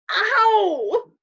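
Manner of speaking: high, loud yelp of pain; yelling in pain. Acted. Patrick yelling at Omri that it hurts. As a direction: cry out sharply, hurt. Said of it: "Patrick kept yelling".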